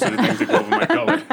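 fast, laughing joke. Real, not acted. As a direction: laugh through the line. Joking confession laughing.

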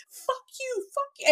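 very high, softened screech; stifled mock scream. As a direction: scream it under your breath, high and squeaky. Retold screaming while pulled away.

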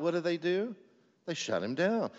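normal talking voice

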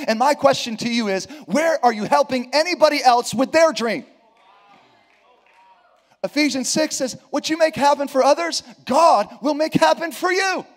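loud, high, shouted preaching; preaching at full force. Real, not acted. Preacher urging the congregation to help, shouting.

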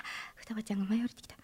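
unvoiced whisper, fast. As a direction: hush as the character arrives.

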